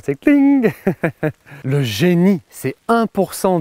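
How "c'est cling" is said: high, loud bright cling of a bulb lighting up; lightbulb cling. Shown as a demonstration. Imitating a lightbulb sound for viewers, a high loud cling. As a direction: ring it out like a lamp switching on. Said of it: "je voudrais bien le bruit avec l'ampoule qui s'éclaire".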